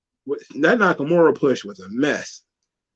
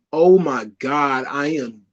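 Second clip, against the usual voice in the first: bored groan; slower than usual. Retold or imitated. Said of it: "people were like".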